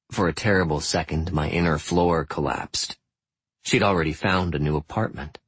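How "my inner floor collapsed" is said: plain reading voice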